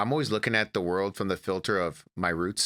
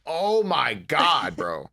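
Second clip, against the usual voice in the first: exasperated mom; 5 semitones higher, 4 dB louder, much slower than usual. Retold or imitated. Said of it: "she'd be like".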